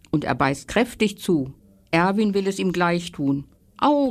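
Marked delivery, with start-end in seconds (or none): 3.8-4.1 cry of pain. A narrator's reading. Bookworm bites hard wood and yelps in pain. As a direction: yelp as if biting on wood. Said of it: "rief er".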